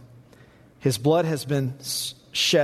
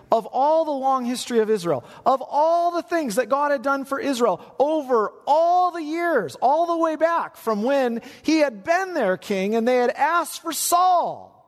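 climbing higher as the outrage builds; rising indignation; 11 semitones higher than usual. Acted. Preacher voices chief priests, building.

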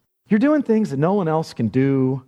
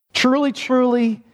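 Truly, truly with the first truly high and falling; emphatic; 6 semitones higher than usual. Shown as a demonstration. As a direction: land each truly with full weight. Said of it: "in the most emphatic, important way possible".

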